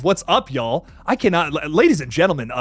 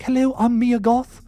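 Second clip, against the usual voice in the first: a lightly done, slow, higher soft-spoken impression; soft-spoken Mia Goth; 6 semitones higher than usual. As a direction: gently mimic her soft, slow way of talking. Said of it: "she's so soft-spoken".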